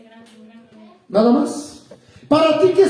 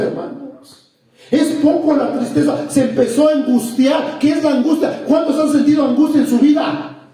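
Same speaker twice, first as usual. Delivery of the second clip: ordinary talk, nothing marked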